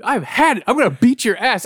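high, fed-up retold threat; mock angry threat. Imitating a friend threatening John at night, angrily fed up. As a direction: pitch it up as an exasperated warning.

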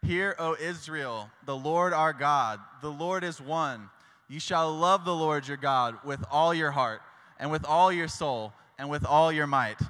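slow, measured scripture reading with the group; reading scripture aloud together. Shown as a demonstration. Leading the youth group in reading scripture, slow and measured. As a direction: recite it slowly with the congregation. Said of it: "We're gonna read it all together".

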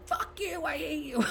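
high, mocking squabble voice; mock bickering. Family fighting before a photo, mock high. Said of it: "everybody's going".

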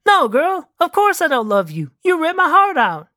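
animated rejection, pitch leaping up; high-swinging, mildly wounded character voice; exasperated Patty. Acted. Plays Patty rejecting Elena.

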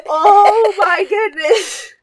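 long, loud, high exclamation; overwhelmed shock. Real, not acted. Daughter to mother after lightning strike, overwhelmed. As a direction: stretch it out in stunned disbelief.

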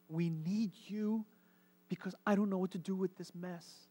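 pleading prayer. Acted. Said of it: "An imperative is a command".

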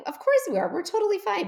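high, loud, over-cheerful reassurance; fake reassurance. Mother covering her worry for her daughter, falsely upbeat. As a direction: insist all is well, overdoing it. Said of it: "making it like we're fine".

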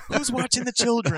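very high put-on woman's voice; fretting wife. Wife questioning husband about the kids, mock panicked squeal. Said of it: "Can you imagine her, like".

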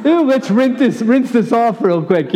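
laughing, hammed-up squeamish parent; grossed-out new parent. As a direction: squeal it like you're holding something slimy.

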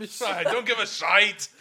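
high, loud, drawn-out Irish brogue; slow comic Irish dismissal; mock Irish accent. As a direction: join in with a hammed-up Belfast accent. Joins the mock Irish bit.

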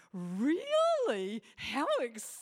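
loud, drawn-out mock enthusiasm; sarcastic fake excitement at her husband. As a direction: oversell the interest so it drips sarcasm. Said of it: "I'm really sarcastic and I go".

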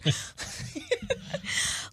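airy laugh out of breath; breathless laugh. Real, not acted. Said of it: "I'm pregnant and I'm out of breath".

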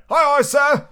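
loud, high crew reply; answering sailors. A narrator's reading. Pirates answering their blind leader, loud and high. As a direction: sing out like an eager crew. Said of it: "answered two or three".

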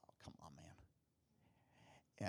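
slow, whispered resignation; exhausted whispered groan; weary whisper. Retold or imitated. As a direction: sigh it out, defeated. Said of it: "I'm just like".

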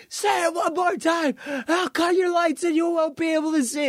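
high-pitched mock shout; Vince McMahon threat. Wrestling boss threatens the heckling crowd, high mock shout. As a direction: imitate an exasperated boss warning a crowd.

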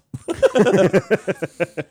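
loud, high-pitched laugh; burst of laughter. Real, not acted. Actor laughing at their own attempt at Welsh, loud burst of laughter.

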